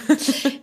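short embarrassed laugh; nervous laugh. Real, not acted. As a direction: laugh out of awkwardness. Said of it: "Ich lache aus Verlegenheit".

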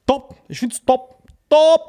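high, loud repeated "Top"; gleeful top. Real, not acted. Goading his friend, high loud "top". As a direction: crow "top" to wind him up.

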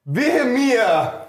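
high, dramatic wail of woe; theatrical lament. Pretend. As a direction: cry it out on stage. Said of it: "musst du sagen „Wehe mir".

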